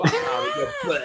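lachend. Real, not acted. Lacht über die besoffene Folge.

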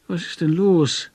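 feigned sleepiness; yawning. A narrator's reading. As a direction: ask through a yawn. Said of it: "fragte ich gähnend".

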